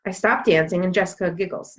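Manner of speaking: ordinary delivery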